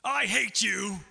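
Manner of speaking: playful fake fury; mock angry outburst. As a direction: snap it like a fickle lover. Acted fickle lover turns.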